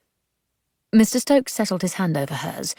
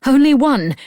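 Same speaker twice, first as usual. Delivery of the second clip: much higher and louder, incredulous; indignant echo; 8 semitones higher, 9 dB louder than usual. A narrator's reading. Grace throwing Stokes's words back, outraged. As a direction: fling his words back at him.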